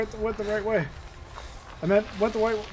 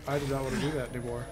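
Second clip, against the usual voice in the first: ordinary talk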